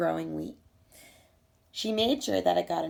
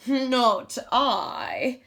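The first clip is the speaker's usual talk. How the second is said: loud, brisk goose voice refusing; a lazy goose brushing off the hen; 4 semitones higher, 5 dB louder than usual. Acted.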